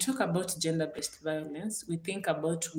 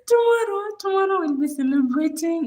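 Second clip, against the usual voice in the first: much higher and louder, bursting with joy; excited cheer; 12 semitones higher, 10 dB louder than usual. Real, not acted. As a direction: shout the day out like good news. Laughing, cheering about tomorrow's celebration.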